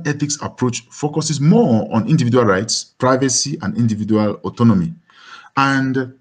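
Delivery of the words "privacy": normal talking voice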